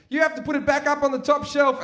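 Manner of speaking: high, hurried sing-out; gloating older brother. Retold or imitated. Older brother drops the vibrator and leaves, calling out high. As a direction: imitate a brother dumping the job on you. Brother dumps task and runs off.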